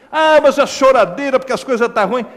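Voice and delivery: mock the complainer; mock whiner. Mocking complaining believer. (imitação chorosa)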